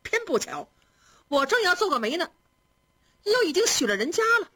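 a touch of foot-stamping frustration; mild exasperation. A narrator's reading. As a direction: lament it in a high voice, lightly vexed. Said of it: "便嗨了一声，跺了跺脚".

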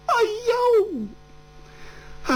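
a high wailing "哎呦", voice breaking; anguished cry. Acted. Storyteller's hero hears the bad news, a high anguished wail. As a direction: cry out in sudden grief.

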